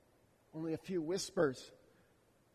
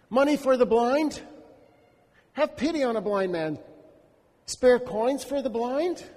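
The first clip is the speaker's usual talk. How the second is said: begging call; 7 semitones higher, 10 dB louder than usual. Acted. Blind beggar begs the passing crowd, loud and pleading. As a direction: call out to be noticed.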